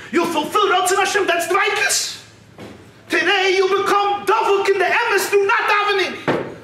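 high, ringing preacher's argument; impassioned rebuttal. Acted. Brother's passionate rebuttal in story.

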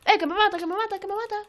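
high, loud panic cry; high repeated alarm; panicked alarm. Real, not acted. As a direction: yelp it over and over under attack. Being attacked in the game.

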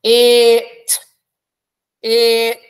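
"It" drawn out long; "It" stretched slowly; stretched sound. Shown as a demonstration. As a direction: hold the word out. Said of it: "So let's stretch it out".

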